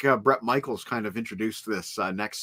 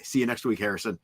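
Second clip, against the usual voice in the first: ordinary talk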